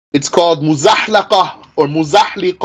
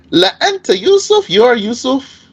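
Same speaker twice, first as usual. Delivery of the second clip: "La anta Yusuf" pitched high and insistent; high, emphatic "Yusuf", held up at the end; 7 semitones higher than usual. Shown as a demonstration. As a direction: drive "you are Yusuf" upward with emphasis. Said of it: "with emphasis though".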